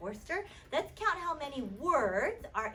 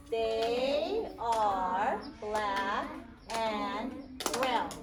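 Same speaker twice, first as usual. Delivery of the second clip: slow word-by-word reading; 2 semitones lower, much slower than usual. Shown as a demonstration.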